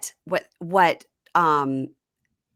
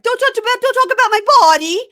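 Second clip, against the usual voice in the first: much higher, loud shriek; imitating her yelling about her body; mock hysterical shriek; 10 dB louder, faster than usual. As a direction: screech it at him. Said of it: "she's still like".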